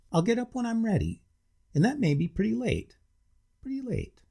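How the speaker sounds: savouring the words; relishing a late lie-in. Acted. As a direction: smack your lips over pretty late. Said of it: "He smacked his lips over the words".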